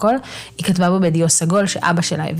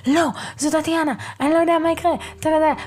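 much higher, panicky rush of words; hammed-up frantic worry, pitched up high; panicked villain; 10 semitones higher, faster than usual. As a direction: imitate him panicking as he runs toward the screams. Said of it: "והוא כזה".

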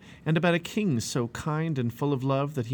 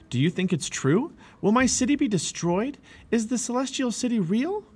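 high, louder urgent questions; anxious questioning; 11 semitones higher, 3 dB louder than usual. A narrator's reading. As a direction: press for answers, pitch climbing.

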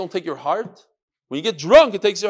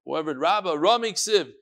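ordinary talk, nothing marked

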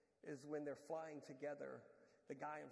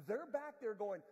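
ordinary talk, nothing marked

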